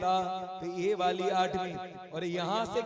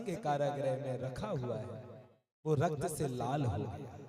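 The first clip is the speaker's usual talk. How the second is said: low, hushed tragic storytelling; grave narration; 5 semitones lower, 6 dB quieter than usual. A narrator's reading. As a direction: tell the killing softly and gravely, pitch dropped. Grave telling of infant's killing. (धीमे, भारी स्वर में दुखद कथा)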